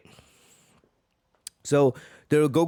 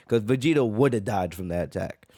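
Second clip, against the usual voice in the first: normal talking voice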